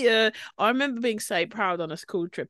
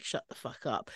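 muttered; 7 semitones lower, 13 dB quieter than usual. Retold or imitated. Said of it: "they're like".

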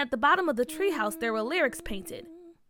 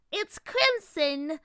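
cold regal fairy queen; 4 semitones higher, much slower than usual. A narrator's reading.

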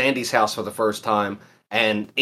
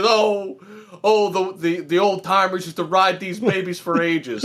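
higher, put-on cartoon voice; excited cartoon voice; 5 semitones higher, slower than usual. Friend quoting SpongeBob admiring the rock, higher cartoon voice. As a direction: raise the pitch like an eager cartoon character. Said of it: "He says".

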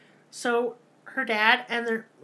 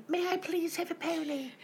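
put-on sweet little girl; 8 semitones higher, 5 dB quieter than usual. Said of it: "I can't do a New Zealand accent".

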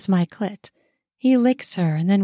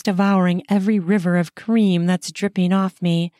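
usual speaking voice

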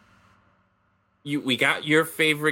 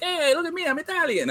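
much higher cartoon Italian voice, hammed up; mock Italian; 14 semitones higher than usual. Mocking the Ferrari boss in a cartoon Italian voice. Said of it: "He's like".

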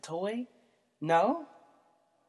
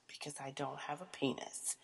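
whispered aside; 2 semitones lower, 12 dB quieter than usual. Real, not acted. Confiding she has no penis, in a whisper. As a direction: breathe it like a secret joke.

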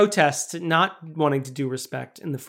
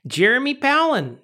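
hammed-up Chicago accent, pitched up; 7 semitones higher than usual. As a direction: announce it as a mock Chicagoan. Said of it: "we haven't done a single Chicago accent".

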